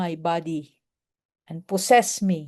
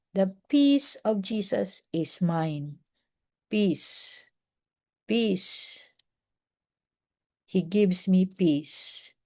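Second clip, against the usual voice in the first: softer, slow, calming self-talk; soft peace mantra; 4 dB quieter, much slower than usual. Shown as a demonstration. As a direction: murmur it to yourself to find calm. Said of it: "sabihin mo sa sarili mo". (mahinahong bulong ng kapayapaan)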